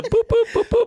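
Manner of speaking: high, loud rhythmic boops; door lock noises. Retold or imitated. Storyteller imitating locks going up and down, comic boops. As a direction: imitate car locks popping up and down.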